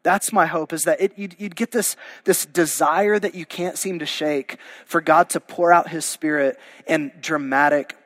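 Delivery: ordinary talk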